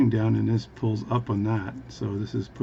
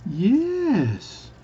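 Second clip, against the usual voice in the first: long, high-held single word; really slow speech; 12 semitones higher than usual. Acted. Bartender confirming to the Newfie, really slowly. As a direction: hold it high, then slide down. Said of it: "is speak really slowly".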